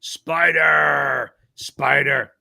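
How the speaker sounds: big hype shout of the name. Real, not acted. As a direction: belt out Spider like a hype call. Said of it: "you get my big one".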